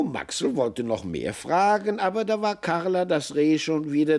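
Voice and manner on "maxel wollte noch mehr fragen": ordinary delivery, nothing marked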